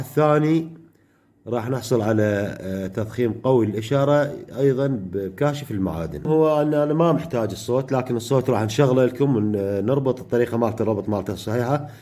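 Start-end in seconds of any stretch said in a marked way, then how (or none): none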